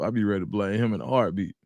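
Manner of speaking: normal talking voice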